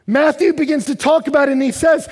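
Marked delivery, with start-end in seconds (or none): none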